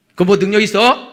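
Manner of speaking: scornful challenge. Acted. Husband challenges wife's praying.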